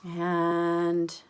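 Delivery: plain reading voice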